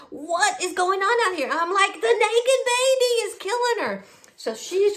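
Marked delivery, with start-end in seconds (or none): 0.1-1.5 higher-pitched retold demand; exasperated husband. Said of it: "My husband's out". 1.9-4.0 very high-pitched mock yell; hammed-up panicked shout; frantic cry. Retold frantic answer to husband.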